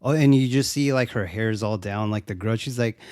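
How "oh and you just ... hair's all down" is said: ordinary delivery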